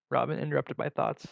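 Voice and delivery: ordinary delivery, nothing marked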